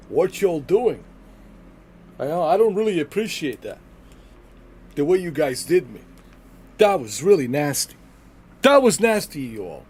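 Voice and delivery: high, loud mock complaint; imitating Texas. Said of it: "He's like".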